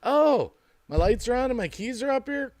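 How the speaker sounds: high, surprised dupe voice; a naive shopper falling for the trick; gullible shopper. Pretend. Mock duped shopper.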